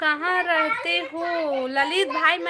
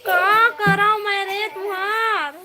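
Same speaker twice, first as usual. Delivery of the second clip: loud, higher, drawn-out complaint; real exasperation; 5 semitones higher, 7 dB louder, slower than usual. Real, not acted. Parent to a nagging child mid-stream, exasperated.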